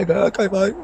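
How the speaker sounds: high, breezy brush-off okay; mock placating. Brushing off a heckler in the pub, mock placating. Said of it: "I'm just gonna say".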